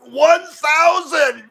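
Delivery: slow, drawn-out happy shout of the number; excited shout. Real, not acted. Host cheering his thousandth subscriber, shouting it slowly. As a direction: yell it out in triumph.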